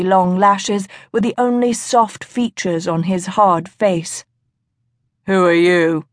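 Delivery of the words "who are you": low, commanding man's demand; deep commanding voice. A narrator's reading. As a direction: ask it like a man used to obedience. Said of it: "his deep voice holding such a strong note of command".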